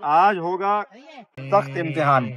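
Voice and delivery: slow, drawn-out announcement of a tough test; mock dramatic challenge. Vlogger to camera, announcing his buffet test, with mock drama. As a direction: declare the food trial like a showdown.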